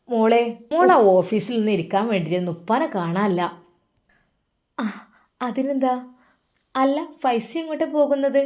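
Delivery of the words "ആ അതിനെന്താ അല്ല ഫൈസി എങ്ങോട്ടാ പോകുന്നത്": put-on high, coy young wife's question; coquettish. A narrator's reading. Wife Mumtas coaxing her husband about his trip, coquettish. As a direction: wheedle it out, playful and sweet. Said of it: "അവൾ കൊഞ്ചലോടെ ചോദിക്കുന്നു".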